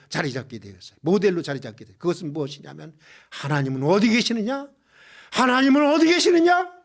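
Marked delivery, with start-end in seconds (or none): 5.3-6.7 raised, louder preacher's challenge; ringing rhetorical demand; emphatic repeated question. Acted. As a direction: pitch the question high and press it on the congregation.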